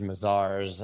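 "Mazars" said as muh-ZARS; "Mazars" ending like cars, stressed there; alternative pronunciation. Shown as a demonstration. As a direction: say "Mazars" with stress on the second syllable. Tries three pronunciations of the name.

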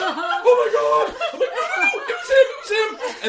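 high, very loud comic panic; panicked mock yell. Driver blaming the other man before the crowd, panicked yell. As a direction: scream the denial, half laughing.

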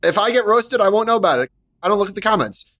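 high-pitched, defensive comeback; defiant retort. Real, not acted. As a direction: brush off the critics, pitch well up. Defiant retort to expected roasting.

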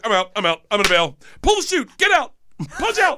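high, hurried comic alarm; mock panicked bail-out. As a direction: abort the mission in mock panic. Retold panicked bail-out in aisle.

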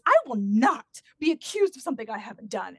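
loud mock outrage; hammed-up indignation; indignant denial. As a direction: imitate her affronted protest, punched out loudly. Acted indignant denial in a quarrel.

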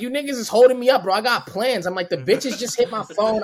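high, pushed re-enacted protest; indignant complaint. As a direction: protest loudly like you're being held up. Re-enacts complaint shouted through cell door.

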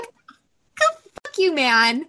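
high, drawn-out mock curse; exasperated retort. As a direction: imitate a fed-up partner's drawn-out outburst. Retold outburst at unhelpful partner.

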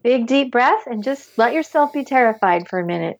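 ordinary talk, nothing marked

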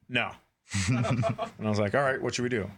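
ordinary talk

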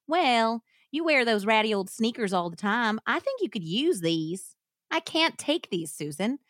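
Southern accent, Sookie-like; hillbilly accent. Shown as a demonstration. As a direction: read on in a put-on hillbilly accent. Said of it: "attempt a hillbilly accent".

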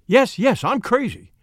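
high-pitched, hurried-sounding acted panic; frantic agreement. A narrator's reading. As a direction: agree desperately to placate him. Frantic wife answers angry husband.

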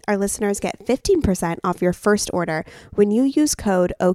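loud, crisp promo-code read. Acted. Co-host giving listeners the discount code, emphatic ad read. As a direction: spell it out clearly for listeners. Reads a sponsor ad.

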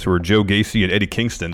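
ordinary talk, nothing marked

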